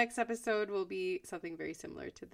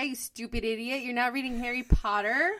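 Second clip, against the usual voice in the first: mock insult; 4 semitones higher, 6 dB louder than usual. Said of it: "when I was like".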